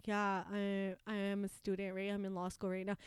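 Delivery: low, soft, meek answer; small-voiced retold confession; imitated sheepish admission. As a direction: admit it quietly to the judge.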